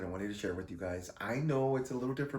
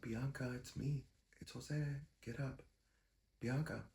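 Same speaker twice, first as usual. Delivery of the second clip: hushed calling; 4 semitones higher, 6 dB quieter than usual. Retold or imitated. Gently waking her at night.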